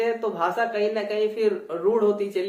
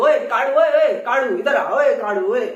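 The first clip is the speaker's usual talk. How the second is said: loud playful call "ओए काड़ू", retroflex flap; 7 dB louder than usual. Retold or imitated. Teacher imitating someone calling a friend loudly. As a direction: shout for Kadu across the yard, laughing a little. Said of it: "जैसे काड़ू".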